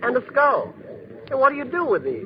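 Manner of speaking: ordinary talk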